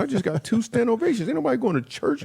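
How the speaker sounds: high-pitched comic indignation; offended, voice shooting way up; indignant protest. Retold or imitated. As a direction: protest in an incredulous high squeal. Outraged at being invited to church.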